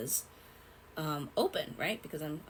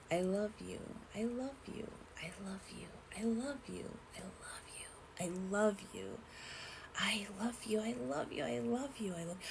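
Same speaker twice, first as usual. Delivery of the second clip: soft repeated murmur; 5 semitones higher, 8 dB quieter than usual. Retold or imitated. Remembered voices repeating love.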